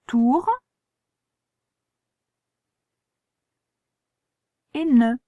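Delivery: spelling out a word to a pupil; syllable by syllable. Shown as a demonstration. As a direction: sound out each syllable for spelling. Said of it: "On écrit re".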